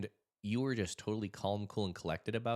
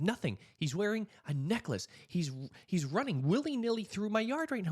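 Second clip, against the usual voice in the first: higher, louder frantic report; panicked; 12 semitones higher, 5 dB louder than usual. Retold or imitated. Caller telling the dispatcher about the intruder, frantic. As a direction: rush it out like someone terrified on a 911 call.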